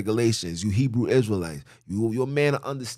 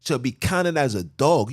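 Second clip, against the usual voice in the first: ordinary talk, nothing marked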